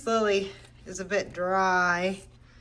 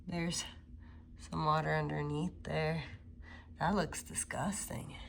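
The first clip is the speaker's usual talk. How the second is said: whispered in real disgust; a hushed, unvoiced ugh-that's-gross aside; 5 semitones lower, 11 dB quieter than usual. Real, not acted. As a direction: breathe it out, grossed out by the mess. Disgusted aside at soggy pot.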